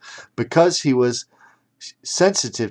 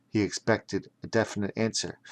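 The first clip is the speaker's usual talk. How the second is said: plain reading voice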